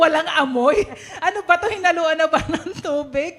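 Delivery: laughing, incredulous retelling; amused disbelief. Retold or imitated. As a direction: act baffled, breaking into giggles. Retells baffled complaint, laughing.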